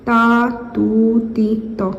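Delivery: ता, तू, ती, त said slowly, each vowel drawn out clearly; teacher drilling ता तू ती त slowly; slow vowel demo. Shown as a demonstration. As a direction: spell out ता, तू, ती, त one sound at a time, slowly. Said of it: "ता नहीं पढ़ेंगे इसको".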